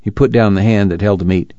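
plain reading voice

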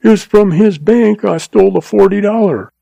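frightened agent's voice; cringing agent. A narrator's reading. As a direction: keep pleading, scared. Agent keeps pleading.

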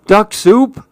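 high, rising startled question; alarmed question. A narrator's reading. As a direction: echo it in alarmed disbelief. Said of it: "Susan gulped".

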